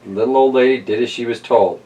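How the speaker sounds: lively read-aloud storyteller voice; story narration. Acted. Host reading Stone Soup to kids, storytelling voice. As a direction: tell it like a bedtime picture book. Reads picture book aloud.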